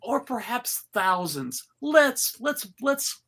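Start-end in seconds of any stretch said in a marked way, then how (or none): none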